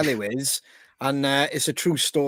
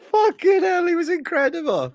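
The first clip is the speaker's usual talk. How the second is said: excited disbelief; 13 semitones higher, 3 dB louder than usual. Real, not acted. Still laughing after the story.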